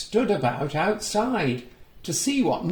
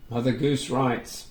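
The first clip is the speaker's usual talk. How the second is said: ordinary delivery, nothing marked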